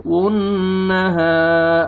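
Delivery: "वुन्नहा" with a long hummed N; slow "वुन्नहा", doubled N lengthened; ghunnah. Shown as a demonstration. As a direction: hold the nasal N in "वुन्नहा". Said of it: "गुन्ना करेंगे".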